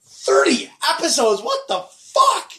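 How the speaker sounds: high, slow, shouted disbelief; disbelieving shout. Retold or imitated. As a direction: yell it in shock. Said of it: "I'm like".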